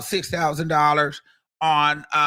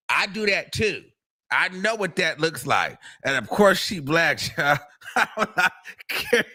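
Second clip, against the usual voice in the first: usual speaking voice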